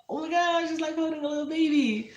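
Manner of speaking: put-on high, squealing baby-talk gush. Talking about her cat, gushing in a high squeal. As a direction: coo over it like holding a newborn.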